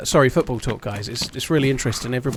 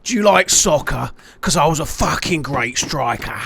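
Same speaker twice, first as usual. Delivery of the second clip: slow, menacing put-on English accent, deliberately bad; terrible English accent. Villain in Cliffhanger taunting Stallone, in a bad English accent. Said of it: "in a terrible English accent".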